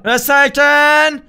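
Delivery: slow, stretched "Festhalten"; drawn-out hold on. Real, not acted. Gamer bracing on the swing, slow and stretched. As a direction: stretch "Festhalten" out slowly, every syllable long.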